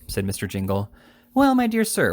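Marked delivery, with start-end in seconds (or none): 1.3-2.1 higher, louder wheedling little lawyer; fussy little attorney. A narrator's reading. Little attorney coaxes Jingle to take the money, higher wheedling.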